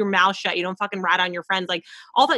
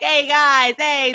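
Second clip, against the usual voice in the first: higher, louder Italian-American greeting; Italian-American wise guy; 6 semitones higher, 5 dB louder, much slower than usual. Acted. Host teasing guest about returning from Italy, Italian-American wise guy. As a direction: hail the guys like a Jersey Italian. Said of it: "you'll be like".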